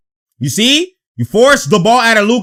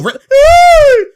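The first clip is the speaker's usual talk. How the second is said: excited yelp; 7 dB louder than usual. Real, not acted. Reacting to play on replay.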